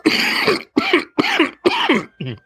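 sputtering cough, drink down the wrong way; choking on mead. Acted. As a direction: cough hard like you swallowed wrong. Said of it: "mead went down the wrong way".

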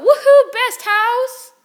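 high, loud whoop of joy; cheering. Real, not acted. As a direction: cheer for your own house. Cheering for Gryffindor.

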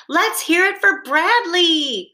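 high rallying call. A narrator's reading. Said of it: "called the lion".

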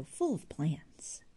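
wistful, slow whisper on the tail of the line; a half-voiced whisper of lost hopes. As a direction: let the thought fade into a breath. Jude recalls his old hopes.

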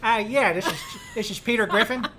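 cartoon dad voice, Boston twang; goofy Boston cartoon dad; Peter Griffin voice. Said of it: "I just turn on the Peter".

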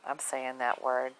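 normal talking voice

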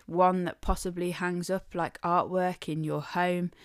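usual speaking voice

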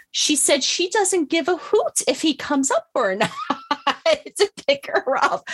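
high-pitched retelling breaking into laughter; imitating laughing nurse. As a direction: mimic someone cracking up as she passes on a message. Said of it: "she's laughing. She goes".

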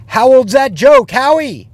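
loud, high taunting shout; repeated heckle. Retold or imitated. Heckler repeating the jab at Howie, loud taunting shout.

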